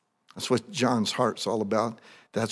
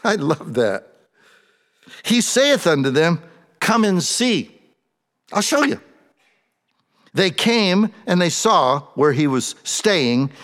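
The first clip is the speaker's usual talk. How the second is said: louder, higher, fervent preaching; preaching at full force; 6 semitones higher, 6 dB louder than usual. Acted. Preacher reads and retells the scene to his congregation, fervent. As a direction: preach it with rising fire to the room.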